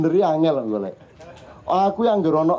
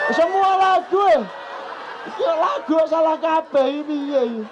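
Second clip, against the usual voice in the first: higher, laughing mock complaint; imitating an upset child; 10 semitones higher than usual. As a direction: play the fed-up kid.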